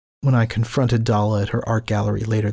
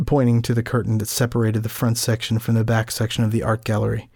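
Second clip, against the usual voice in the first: ordinary talk, nothing marked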